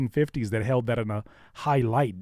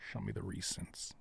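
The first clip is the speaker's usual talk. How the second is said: whispering; 4 semitones lower, 17 dB quieter than usual. Real, not acted. Muttering to self at computer.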